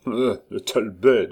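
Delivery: character voice, grumbled aside; grumbling. Acted. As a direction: grumble it to yourself. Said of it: "grommela-t-il". (en grommelant)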